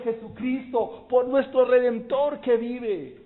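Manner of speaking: loud, high, slowing into praise; preaching at full force. Acted. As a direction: cry it out, then exalt. Predica con toda fuerza. (predicación a voz en cuello, alta y fuerte)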